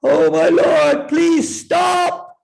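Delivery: loud, slow desperate shout. Acted. Parvati shouting to Lord Shiva to stop, loud and high. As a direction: scream at him to stop. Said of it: "and shouted".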